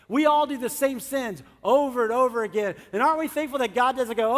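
normal talking voice

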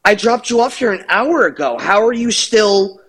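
high, quick incredulous questioning; retold indignant complaint; imitated exasperation. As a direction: act out disbelief, pitch climbing. Retells the other's exasperated questioning.

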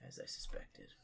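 hushed knowing whisper. Acted. Orc checking legs under cloak, whispered aside. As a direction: breathe it like a secret confirmed. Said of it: "he's like".

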